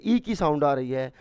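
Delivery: ordinary talk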